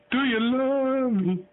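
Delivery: drawn out up high, like talking to a bird; high slow parrot-coaxing voice. Shown as a demonstration. Owner coaxing his parrot to talk, high and drawn out.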